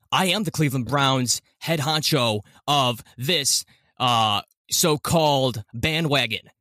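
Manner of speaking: slow, decisive radio-host voice; Jim Rome. Retold or imitated. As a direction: punch out each phrase with dramatic pauses. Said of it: "tried to do a Jim Rome impersonation".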